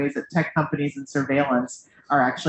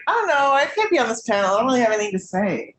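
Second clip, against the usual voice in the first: higher, quicker mock protest; Emmy; 6 semitones higher, faster than usual. Teasing a co-panelist about not wanting to join the panel, mock reluctance. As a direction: imitate a panelist's reluctant protest, lightly hurried. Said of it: "Emmy was like".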